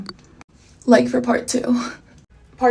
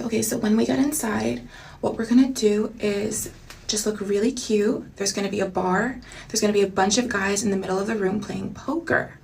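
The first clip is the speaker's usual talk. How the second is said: Dakota's soft voice; 8 dB quieter than usual. Retold or imitated. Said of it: "that's how she talks".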